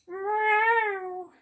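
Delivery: high, drawn-out mock meow; girl's cheeky cat meow. Girl mimicking the cat to fool Skullface, cheeky meow. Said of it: "yelled back at her".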